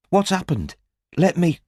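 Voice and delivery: rushing to help him; worried young woman reaching out; Robin, alarmed. A narrator's reading.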